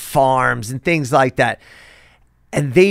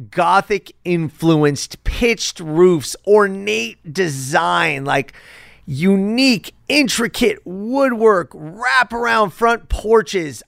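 savoring each detail; 3 semitones higher, much slower than usual. Real, not acted. Savoring list of house features.

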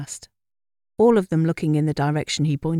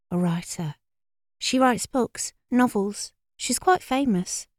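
put-on higher girlish know-it-all voice; air of authority; 6 semitones higher than usual. A narrator's reading. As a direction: tell it as the one who knows. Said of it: "said with an air of authority".

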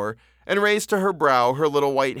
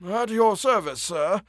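put-on old clerk voice; 7 semitones higher, slower than usual.

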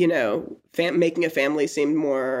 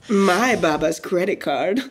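put-on snooty rich girl. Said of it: "It's fake, and this is a character".

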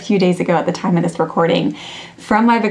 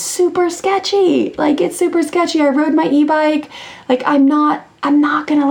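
higher, mocking teenage voice; imitating her daughter; teen daughter imitated; 9 semitones higher than usual. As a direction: lightly parody a sensible teen. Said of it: "she's the kid who's like".

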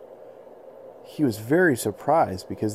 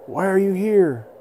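high, louder mammoth voice; curious mammoth; 9 semitones higher, 6 dB louder than usual. Acted. Mammoth asking the boy why he came. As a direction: ask it wide-eyed.

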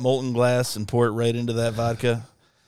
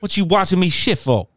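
dog's voice; 6 semitones higher than usual. Pretend. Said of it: "dog's voice".

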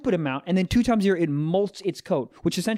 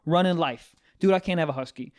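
ordinary delivery

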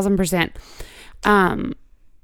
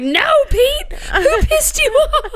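high, drawn-out comic demand; mock exasperation; 3 dB louder, slower than usual. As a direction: whine the question through laughter. Retold exasperated repeated question.

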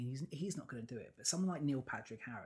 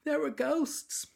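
high, slow spooky ghost voice; eerie drawn-out announcement; 13 semitones higher, 7 dB louder, slower than usual. Pretend.

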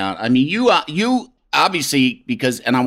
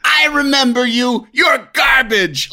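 higher and louder, a mock shout at the customer; angry restaurant owner; 11 semitones higher, 3 dB louder, slower than usual. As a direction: bark it like a grudge-holding owner. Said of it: "the owner would say".

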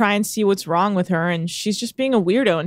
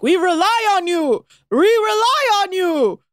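high and loud "We rely on you", pleading and insistent; mock the intense neighbour begging; hammed-up aggressive yacht owner; pushy Norwegian guy; 11 semitones higher, 4 dB louder than usual. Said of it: "the guy keeps being like".

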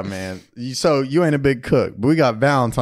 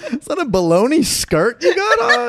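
high, laughing mock question; laughing mockery; 14 semitones higher, 4 dB louder than usual. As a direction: tease it through laughter. Mocking an outfit, laughing.

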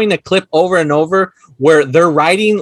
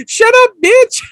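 high-pitched mock outburst; mock snapping retort; 4 dB louder than usual. Quoting the film's phone quarrel, high mock retort. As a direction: cut the caller off in a high imitation voice.

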